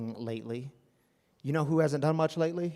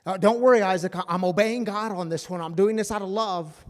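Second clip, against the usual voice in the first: much louder, higher, insistent fake comfort; louder "don't worry", pressing it on him; loud mock reassurance; 5 semitones higher, 9 dB louder than usual. Abraham to Isaac before the sacrifice, loud insistent reassurance.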